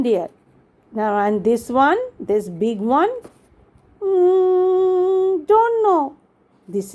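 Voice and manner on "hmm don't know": put-on high child voice, long thinking hum; stumped little kid, pitched up; little girl Rini.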